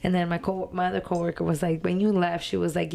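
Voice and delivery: normal talking voice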